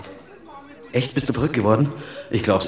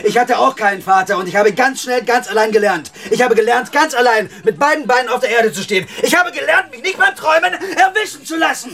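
much higher, louder scornful yell, rising to a peak; furious shouting; 13 semitones higher, 3 dB louder than usual. Real, not acted. Son shouting at crying mother that he learned alone, furious yell. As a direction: hammer every word at her, shouting. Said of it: "schrei nicht so".

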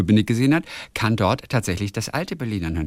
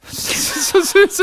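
laughing; 4 dB louder than usual. Real, not acted. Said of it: "liest Hörerpost gerührt vor".

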